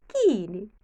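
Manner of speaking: usual speaking voice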